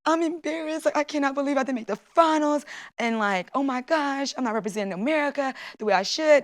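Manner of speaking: a touch of the tearful plea of a defeated athlete; retold crying. Olympian alone in the breezeway, mild tearful voice. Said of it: "And I was just crying".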